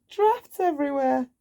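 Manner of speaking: high draughts everywhere; proud of his joke; Mister Nonsense showing his draughts; pleased pun. Acted. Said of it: "he said".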